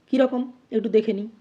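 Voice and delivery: ordinary delivery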